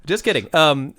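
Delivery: ordinary talk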